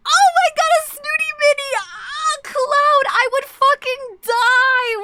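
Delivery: high, loud squealing delight; cracking high squeals of excitement; excited squeal. Real, not acted. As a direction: shriek with joy at the news. Excited squeal over snooty mini.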